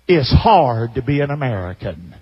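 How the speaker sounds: low, sulky whine of a well-off retiree; quoting the complainer. Retold or imitated. Preacher mimics the rich retiree griping about America, in a low sulky whine. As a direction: mope it out like a spoiled complainer. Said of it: "And then he said this".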